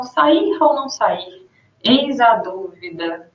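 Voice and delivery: slow, hesitant musing; reflective pause. Shown as a demonstration. Teacher repeating the pensive ellipsis example. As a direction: trail off as if thinking. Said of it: "uma reflexão".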